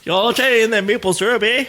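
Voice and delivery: mock Canadian. Mocking Canadian podcasters talking maple syrup, hammed-up Canadian accent. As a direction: ham up a Canadian "eh". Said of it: "they're not French Canadian".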